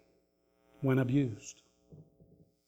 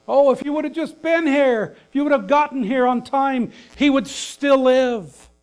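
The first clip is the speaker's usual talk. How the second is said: high, reproachful mock plea; chastising Martha; 8 semitones higher than usual. Preacher voices Martha scolding Jesus for being late, high and reproachful. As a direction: pitch it up like a grieving sister scolding.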